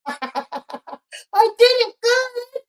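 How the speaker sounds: laughing, very high and drawn-out slow; laughing pride. Real, not acted. Streamer proud of his impression, laughing very high. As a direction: crow over it through laughter.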